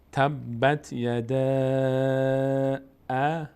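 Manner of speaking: "تبت یدا" slowly recited, "یدا" held very long; recited madd. Shown as a demonstration. Teacher reading the verse with madd, long-held "یدا". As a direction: sustain the final A of "یدا" on a level tone. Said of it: "مد الان".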